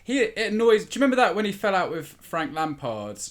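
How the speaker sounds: usual speaking voice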